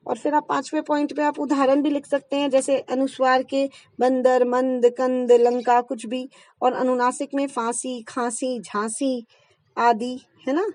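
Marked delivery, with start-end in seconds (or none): none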